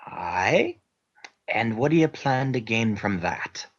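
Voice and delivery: Scottish brogue with aye and lad; gruff Scottish interrogator, lightly done; Scottish accent. Acted. Interrogator pressing the spy in a light Scottish accent. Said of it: "continues Scottish character".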